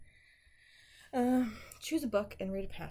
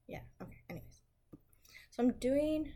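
normal talking voice

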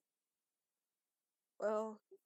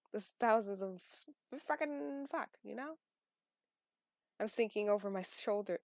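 ordinary delivery

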